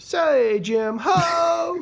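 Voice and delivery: a pimp holler lost in giggles; pimp voice laughing. Retold or imitated. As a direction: try the pimp line but crack up. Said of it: "And he goes".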